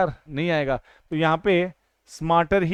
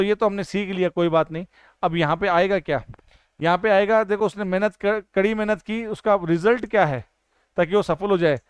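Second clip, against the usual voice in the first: usual speaking voice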